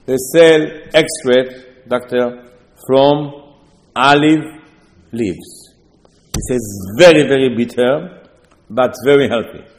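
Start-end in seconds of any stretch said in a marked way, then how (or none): none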